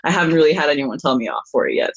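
normal talking voice

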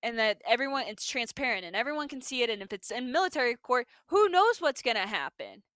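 ordinary talk

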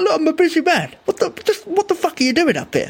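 high-pitched mock outburst at a time-waster; mock yell. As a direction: shriek it up high like a fed-up busy man. Retells yelling at the lookout.

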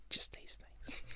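bored whisper. Pretend. Whispered checkout line.

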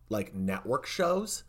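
ordinary talk

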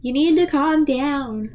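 slowed, measured android; automated calm-down alert voice. Acted. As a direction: stretch it out like a calming machine. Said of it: "Did you read it in another voice?".

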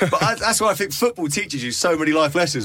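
talking through laughter; laughing. Real, not acted. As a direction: laugh while you say it. Joking about Michael Owen betrayal.

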